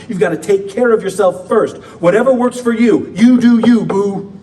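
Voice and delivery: mock the world's me-first slogan, sassy and loud. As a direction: toss it off like a sassy friend. Mocking the world's selfish advice.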